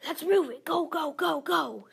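loud barked orders; shouting. Acted. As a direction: yell it like a drill captain. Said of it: "shouts the captain".

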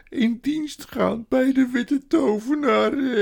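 tearful creature voice; woeful faun confessing. A narrator's reading. Weeping faun confesses in story.

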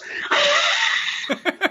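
long mock hiss like a cornered beast; animal hiss. Imitating a man lunging on his spilled beer, hissing. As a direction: hiss wildly, guarding your pile. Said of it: "like, hisses".

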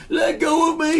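very high, loud yell; high-pitched acted cry for release; captive's shout. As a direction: yell as if grabbed and carried away. Halfling struggles in giant's grip.

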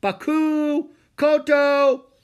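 very high, loud held calls; long, carrying shout; ringing shout. A narrator's reading. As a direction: holler the names from far away. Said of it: "he heard a voice shout".

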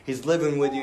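ordinary talk

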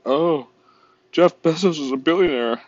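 mock-indignant whine; mocking critic. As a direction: sneer it like a smug complainer. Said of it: "They're like".

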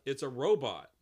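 usual speaking voice

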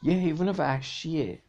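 high-pitched, understated alarm; mild shout. A narrator's reading. Ryan warns of a wild animal, mild high shout. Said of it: "رایان فریاد زد".

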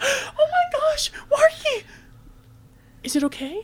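high, near-crying mock panic; mortified squeal. Fan realizing her costume failed, mock horrified. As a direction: gasp it out in embarrassed shock. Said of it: "And she goes".

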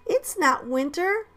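put-on high, slow kid voice; amused child. A narrator's reading. Giggling child correcting teacher, playful child voice.

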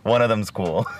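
giggling through the words; can't hold back the laughter; laughing while talking. Real, not acted. Laughing over his Tinder stats.

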